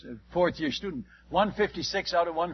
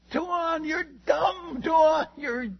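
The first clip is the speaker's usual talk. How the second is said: retold yell; 10 semitones higher, much slower than usual. Nimrod yelling at the missionary, high mock shout. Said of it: "Nimrod behind me yells at me".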